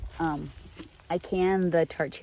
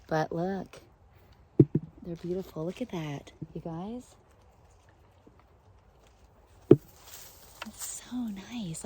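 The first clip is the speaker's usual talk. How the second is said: hushed whisper in the orchard; 5 dB quieter than usual. Real, not acted. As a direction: keep it soft and breathy. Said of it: "Why are you whispering?".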